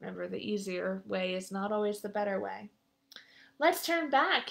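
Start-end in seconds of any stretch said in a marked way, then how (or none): 3.6-4.5 trembling. A narrator's reading. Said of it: "he said, trembling".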